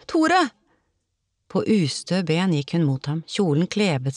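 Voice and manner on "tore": very high, despairing cry; desperate shout. A narrator's reading. Olav calling for Tore in the waves, desperate shout. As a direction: cry the name out higher, in despair. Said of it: "ropte han fortvilet".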